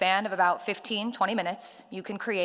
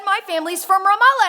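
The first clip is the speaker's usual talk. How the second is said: excited; 11 semitones higher, 10 dB louder than usual. Retold or imitated. Driver's excited reply.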